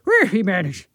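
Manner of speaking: muddled attempt at soft Irish; hard-to-follow mock-Irish lilt. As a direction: put on a gentler Irish brogue, words garbled. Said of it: "A softer Irish".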